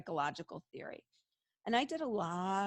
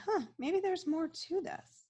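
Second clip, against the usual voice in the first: a light high-pitched imitated "huh" of sudden curiosity; mildly surprised musing; 10 semitones higher than usual. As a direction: voice others half-convinced, pitched higher. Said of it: "gives people a chance to say".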